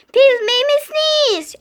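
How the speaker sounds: loud complaint. Acted.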